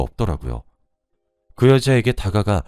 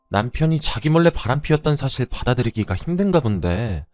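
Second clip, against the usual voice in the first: higher-pitched, shameless mistress; haughty, unapologetic, pitched up; brazen; 7 semitones higher than usual. A narrator's reading. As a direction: brazenly rub it in to the wife. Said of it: "하고 뻔뻔스럽게 나오는 게 아니겠어요?".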